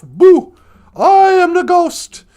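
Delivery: loud, drawn-out ghostly boo and intro; spooky ghost announcement. Acted. Ghost startles Scrooge at night, booming spookily. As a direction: boom it out slowly like a storybook ghost.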